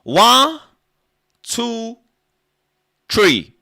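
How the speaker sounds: high loud shouted count; mock Patwa counting. Comic counting in Patwa, loud high yells. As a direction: yell the numbers high, dropping at the end.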